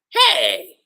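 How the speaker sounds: high, loud indignant "Hey!"; a playful hammed-up yell; mock shout. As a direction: call after him, indignant. Rebecca calls after Shiki.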